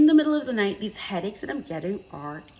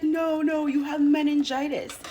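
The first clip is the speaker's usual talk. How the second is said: higher, patient doctor voice; doctor correcting her; 9 semitones higher, 4 dB louder than usual. Retold or imitated. The doctor answering her panic, higher and calm. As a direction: correct her calmly but firmly. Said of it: "She's like".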